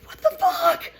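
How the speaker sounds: very high startled what the fuck; high squeal of shock; shocked shriek. Retold or imitated. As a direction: shriek it in alarm, half laughing. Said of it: "I'm like".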